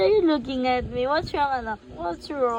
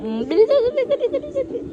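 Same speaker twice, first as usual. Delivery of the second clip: being tickled; 11 semitones higher, 3 dB louder, much faster than usual. Real, not acted. Tickled, urging baby.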